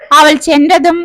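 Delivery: ordinary delivery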